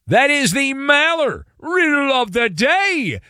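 high, ringing segment sign-off; announcer flourish. Real, not acted. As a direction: proclaim it grandly, stretching the last word. Announces a named show segment.

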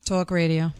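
low, toned-down New York "talk radio"; toned-down New York accent. Shown as a demonstration. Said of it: "oh, she toned that one down".

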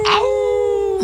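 high wolf howl; werewolf howl. Pretend.

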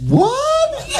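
very high, long squeaky "What?"; confused. Retold or imitated. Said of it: "I was so confused".

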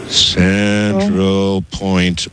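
CENTRAL POINT drawn out very slowly; slow dictation. Real, not acted. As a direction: stretch out "Central Point" for someone writing it down. Repeats address for caller writing it down.

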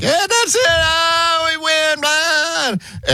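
very high, sustained victory cry; hammed-up, high-pitched game-winning call; radio play-by-play caller. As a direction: imitate an announcer losing it over a win. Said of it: "a guy calling the radio broadcast".

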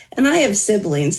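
ordinary talk

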